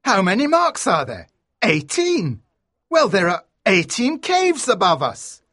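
high, eager character voice; excited deduction. A narrator's reading. Lord working out the map for companions, excited. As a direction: reason it out with rising excitement. Lord John solving the puzzle.

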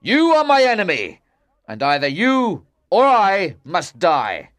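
loud, high-pitched accusations; angry shout. A narrator's reading. King challenging Lancelot at his castle, angry shouting. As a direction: hurl each charge at full voice. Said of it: "shouted the king".

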